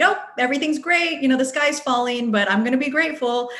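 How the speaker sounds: high, breezy Pollyanna cheer; mock Pollyanna. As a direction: put on relentless positivity. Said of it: "you can't be too Pollyanna".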